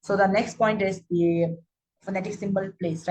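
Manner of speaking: usual speaking voice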